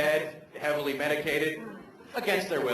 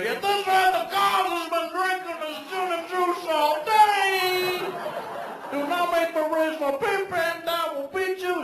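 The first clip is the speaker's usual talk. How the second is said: strut through it as the Cosby pimp; pimping Bill Cosby voice; 13 semitones higher than usual. Acted. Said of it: "angry, pimping Bill Cosby".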